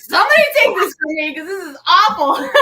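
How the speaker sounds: fast, high, loud plea cracking into laughter; high and loud, half laughing, begging to be rid of the drink. Retold or imitated. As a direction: beg someone to take it while laughing. Retold pleading about awful scotch.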